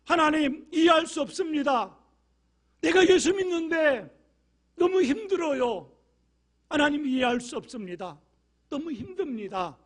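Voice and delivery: loud, high shouted plea; shouting desperate prayer; raw honest prayer. Retold or imitated. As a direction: cry out your confusion to God. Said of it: "하나님께 솔직하게".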